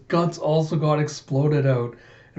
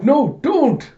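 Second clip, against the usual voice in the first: high, loud plea to stop; panicked cry; 12 semitones higher, 6 dB louder than usual. Retold or imitated. Koyomi begging Alita not to shoot, high panicked cry. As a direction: cry out in alarm, pitched way up. Pleading cry in retold manga.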